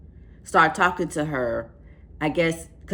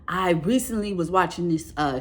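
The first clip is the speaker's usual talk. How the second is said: ordinary talk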